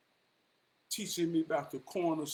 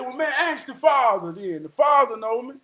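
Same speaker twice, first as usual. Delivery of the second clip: louder and higher, pushing his case; insisting loudly; 6 semitones higher, 10 dB louder than usual. Retold or imitated. The Pope insisting to Jesus at the gate, loud. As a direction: argue your way in at the gate.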